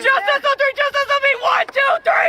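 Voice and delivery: very high falsetto huddle speech; mocking a woman's pep talk. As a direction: squeak it like a female coach firing up the team. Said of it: "Let's hear 'em motivate you".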